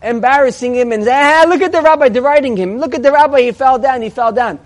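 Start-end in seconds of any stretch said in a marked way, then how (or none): 1.1-4.6 raised-pitch mocking taunts; hammed-up heckling from rowdy boys; jeering troublemakers. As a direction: jeer at the rabbi who fell. Boys jeer at fallen rabbi.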